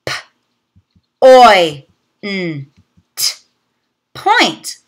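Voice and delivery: "P, oi, n, t" said as separate sounds, then blended into "point"; sounding out. Shown as a demonstration. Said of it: "Let's practice together".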